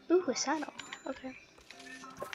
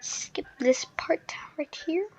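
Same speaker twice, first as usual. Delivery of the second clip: whispered aside; 10 dB quieter than usual. Real, not acted. Playful instruction to viewers to skip.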